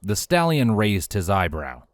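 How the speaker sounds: ordinary delivery, nothing marked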